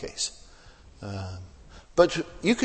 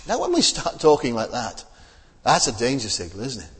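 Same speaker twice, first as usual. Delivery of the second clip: ordinary delivery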